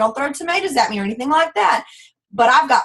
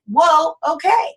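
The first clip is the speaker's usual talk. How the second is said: drawn-out, higher "Whoa, okay" in growing wonder; slow amazed whoa; 5 semitones higher, 4 dB louder, slower than usual. Retold or imitated. Telling her team about her growing paychecks, a slow amazed whoa. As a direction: let the surprise dawn slowly.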